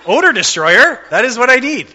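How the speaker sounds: light up like you just found the perfect fix; excited discovery. Retold or imitated. Driver retelling finding odor destroyer, gleefully excited and high.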